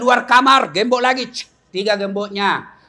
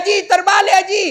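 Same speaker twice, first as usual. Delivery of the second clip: high, quick, raised-voice correction; urgent calling out; 9 semitones higher, 5 dB louder than usual. Acted. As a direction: holler that the phone is the wrong way round. Meneriaki Haji yang salah pegang telepon. (berseru lantang membetulkan)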